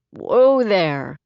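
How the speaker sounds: deep voice. A narrator's reading. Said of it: "came a deep voice".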